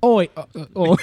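ordinary delivery

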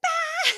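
high, loud imitated "bah" blast; short comic shriek of rage; mock outburst yell. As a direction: explode in a hammed-up yell. Imitates angry person exploding.

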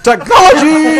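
high, drawn-out cry of wonder; excited shout. Pretend. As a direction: shout it in awe.

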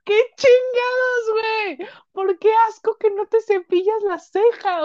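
high, slow, half-laughing protest; laughing outrage. Real, not acted. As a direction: cry out between laughs. Indignada riendo. (indignada entre risas, voz aguda)

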